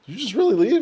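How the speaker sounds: disbelief. Retold or imitated. Retelling asking friend if he left.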